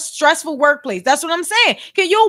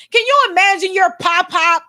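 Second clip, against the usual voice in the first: usual speaking voice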